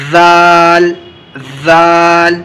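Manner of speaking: زال with tongue tip between the teeth; letter demo. Shown as a demonstration. Quran teacher demonstrating زال. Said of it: "زال کا تلفظ".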